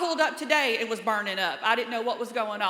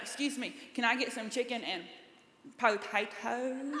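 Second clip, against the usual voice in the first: softer, very slow on potatoes; slow careful no-accent voice; 7 dB quieter than usual. Retold or imitated. Ordering food, slow and careful to hide her accent. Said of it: "you say things slow when you're trying to say it without an accent".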